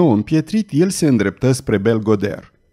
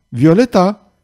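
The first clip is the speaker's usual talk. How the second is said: a higher, louder second call; 6 semitones higher, 4 dB louder, slower than usual. Acted. Belgoder calling Violeta to come and sing, loud. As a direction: shout her name across the square.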